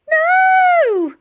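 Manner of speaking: very high, very loud cry of no; desperate shout. A narrator's reading. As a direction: scream it as she is torn away. Friend torn away on ice.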